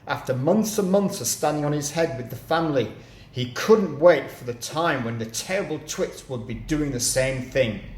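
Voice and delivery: ordinary delivery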